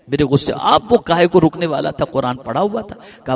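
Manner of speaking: ordinary talk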